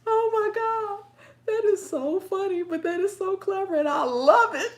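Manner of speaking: high, loud delight on the edge of laughter; delighted, laughing. Real, not acted. Host loving viewer's clever trick, laughing with delight. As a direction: gush while cracking up, building to a loud finish.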